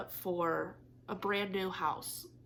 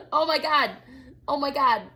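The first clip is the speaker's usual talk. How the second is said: loud, higher burst of alarm; startled exclamation; 6 semitones higher, 11 dB louder than usual. Real, not acted. Startled loud cry as her cat climbs her back. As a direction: blurt it as the cat lands on you.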